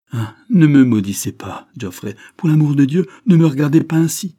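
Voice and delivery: distressed, urgent entreaty; desperate pleading. A narrator's reading. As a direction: beg him, voice leaping up and out. She begs him not to curse her.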